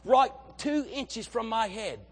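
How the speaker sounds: a playful Aussie Crocodile Hunter take; mild Australian accent as an excited TV naturalist; Steve Irwin impression. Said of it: "He's like".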